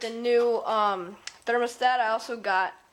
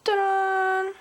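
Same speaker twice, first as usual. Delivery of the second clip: ta-da reveal; 7 semitones higher, 3 dB louder, slower than usual. Real, not acted. Reveals the new thermostat.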